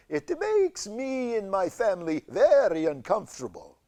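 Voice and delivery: a polite Dracula asking a favor; Bela Lugosi cancer voice. Acted. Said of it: "Your voice reminds me of Bela Lugosi".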